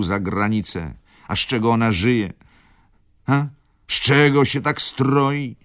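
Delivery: high, mounting reproach; dramatic admonishing. A narrator's reading. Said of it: "głębokim głosem". (dramatyczne, coraz bardziej oburzone napominanie)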